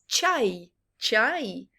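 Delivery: ordinary delivery, nothing marked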